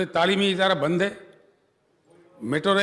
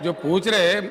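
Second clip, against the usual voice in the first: ordinary talk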